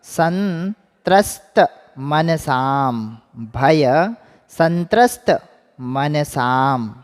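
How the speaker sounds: संत्रस्त मनसाम stretched out very slowly; very slow model. Shown as a demonstration. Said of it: "उच्चारण कीजिए आप लोग भी".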